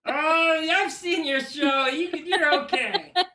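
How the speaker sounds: put-on high, goofy, childlike bird voice; Big Bird voice. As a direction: squeeze through the door as the giant bird. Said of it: "It's Sesame Street's Big Bird".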